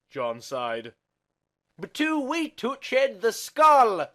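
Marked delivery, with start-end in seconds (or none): 1.8-4.1 high, loud, slow strained plea; high protest. Acted. John protests to Sherlock, high, slow and strained. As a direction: stretch it out high and pleading.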